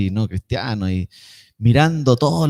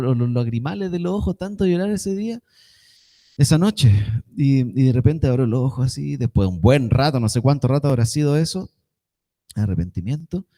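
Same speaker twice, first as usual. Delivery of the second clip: normal talking voice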